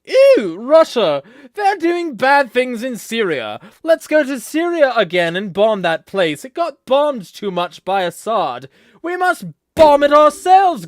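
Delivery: very high, very loud cartoonish outrage; mock war hawk. Mocking hawks demanding to bomb Syria, hammed-up war hawk. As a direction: shriek it like a hammed-up jingoist.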